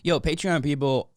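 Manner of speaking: ordinary talk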